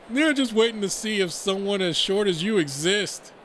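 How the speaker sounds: ribbing a buddy across the table; teasing friend. Acted. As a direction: chime in with a loud jab. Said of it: "His friend chimes up".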